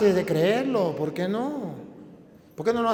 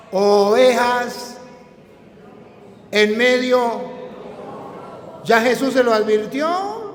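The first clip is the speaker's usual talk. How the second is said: higher, louder rebuking sermon; fiery preaching; 7 semitones higher, 7 dB louder than usual. Acted. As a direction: hammer each question at the flock.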